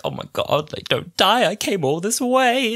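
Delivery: high, wailing imitation of sobbing; mock sobbing. Rose sobbing as the dying Doctor starts to change, mock wail. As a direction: whine it like she's crying at him.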